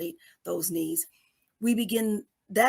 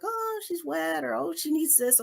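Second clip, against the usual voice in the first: fussing caregiver; 7 semitones higher than usual. Acted. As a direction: play people fussing over a crying baby. Voicing people fussing over baby.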